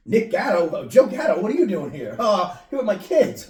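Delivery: loud, high starstruck call; excited fan. Pretend. Fan spotting a celebrity at Chuck E. Cheese, loud and excited. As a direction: act thrilled to spot a celebrity.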